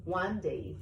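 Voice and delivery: usual speaking voice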